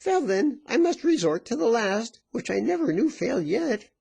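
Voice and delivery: high-pitched coaxing threat; high, domineering question; insistent virago. A narrator's reading. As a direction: press him in a high woman's voice.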